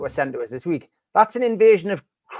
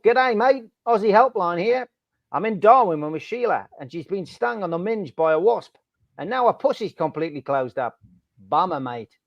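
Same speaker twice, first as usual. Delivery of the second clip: put-on Aussie accent; 3 semitones higher than usual. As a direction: play an Aussie helpline bloke with an Australian accent. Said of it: "Not a bad Aussie accent".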